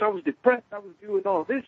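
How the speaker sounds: high-pitched aggrieved complaint; pissed off. Retold or imitated. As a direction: act out a wounded protest. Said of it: "it pissed me off 'cause I'm like".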